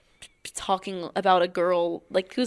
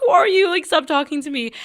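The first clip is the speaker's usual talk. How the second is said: annoyed stranger; 7 semitones higher, 8 dB louder than usual. Retold or imitated.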